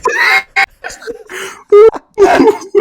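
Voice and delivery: high, loud crying laugh; shrieking howl of laughter. Real, not acted. Friend howls at the outrageous line with a shrieking laugh. Howling at the comment.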